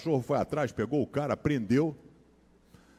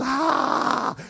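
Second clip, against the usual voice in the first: high yelped scream of alarm; panicked scream; 11 semitones higher than usual. Retold or imitated. Son runs from bed toward his mother, high panicked scream. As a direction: let out a sudden high scream. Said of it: "eu corria gritando".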